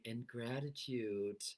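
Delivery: slow, dull mock gratitude; flat gratitude. Shown as a demonstration. Coach showing the wrong, flat way to say gratitude. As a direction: say it with no feeling at all. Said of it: "I'm not saying".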